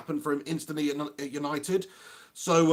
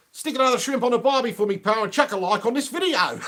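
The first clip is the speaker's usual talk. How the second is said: higher, louder put-on Aussie accent, breaking into a laugh; 6 semitones higher, 5 dB louder than usual. Greeting an Aussie viewer in a hammed-up Aussie accent. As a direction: ham up a cheery Australian bloke. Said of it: "doing the old Aussie accent".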